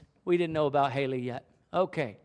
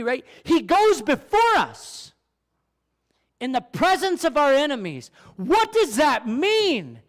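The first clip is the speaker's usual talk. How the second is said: preaching at full force; 8 semitones higher, 5 dB louder than usual. Acted. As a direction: lift the congregation with each phrase.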